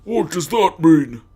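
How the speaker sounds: put-on high, loud, slow clueless girl; dim-witted Mary voice.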